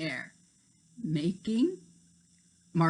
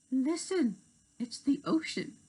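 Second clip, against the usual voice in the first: higher, light fairy voice; character voice; 6 semitones higher than usual. Acted. Tink hearing the sea, high fairy voice. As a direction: pipe up brightly as a small fairy.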